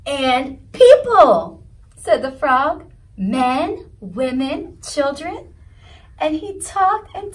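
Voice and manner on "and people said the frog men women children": louder, slow grand announcement; grand reveal. A narrator's reading. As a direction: save the best for last. Frog announcing people.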